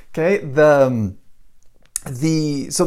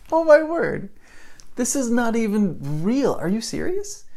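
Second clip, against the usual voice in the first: high-pitched, half-laughing astonishment; can't believe the nerve of this guy; astonished disbelief; 8 semitones higher than usual. Retold or imitated. Couple reacts to the salesman's desert-bee tale, astonished.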